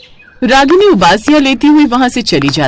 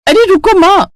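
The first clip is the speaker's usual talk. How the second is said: much higher, urgent plea; calling out; 10 semitones higher, 3 dB louder than usual. Acted. Daughter urging mother not to hang up, high urgent call. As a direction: stop her before she hangs up.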